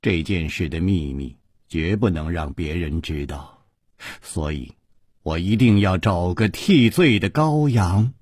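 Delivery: low, measured voice of a plotting ruler; low-pitched, deliberate menace; cold schemer. A narrator's reading. Narrator voices 银姬's cold scheming speech.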